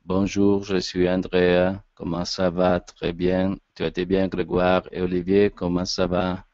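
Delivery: every phrase on the same low, unvarying tone; monotone demo. Shown as a demonstration. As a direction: drone through the greetings without changing tone. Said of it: "je peux pas parler avec le même ton tout le temps".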